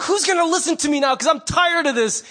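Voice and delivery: fed up, crying out for someone to listen; mock exasperated cry. Wronged believer crying out that no one listens, mock shout. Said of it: "we're like".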